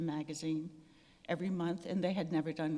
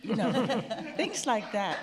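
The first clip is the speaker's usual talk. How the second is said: laughing; 4 semitones higher, 7 dB louder than usual. Real, not acted. Laughing after the card jokes.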